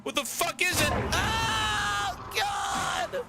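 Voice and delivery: high panicked screams; panicking gamer. Real, not acted. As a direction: scream to get out. Monster appears, panic.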